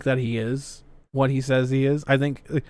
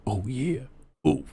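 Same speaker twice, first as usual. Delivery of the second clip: softer, muttered to oneself; quiet muttering; 5 semitones lower, 8 dB quieter than usual. Retold or imitated. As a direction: mumble it to yourself. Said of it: "quietly, talking to themselves".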